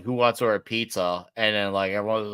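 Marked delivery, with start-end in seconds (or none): none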